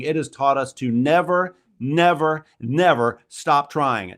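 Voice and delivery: rising punch on never; building emphasis. Real, not acted. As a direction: hammer never, never, never home.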